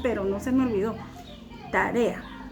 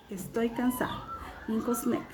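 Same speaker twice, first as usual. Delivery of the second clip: tired; 7 dB quieter than usual. Shown as a demonstration. Teacher acts tired students after too much homework. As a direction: sigh it out like a worn-out student. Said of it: "Así están ustedes cuando hay que hacer mucha tarea".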